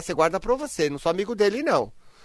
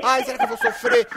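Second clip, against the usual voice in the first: higher, louder mock fretting; anxious inner self; 7 semitones higher, 6 dB louder than usual. Host imitating the anxious side, mock whining. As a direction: whine like a drama queen. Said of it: "como ela é ansiosa".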